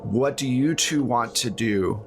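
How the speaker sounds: ordinary delivery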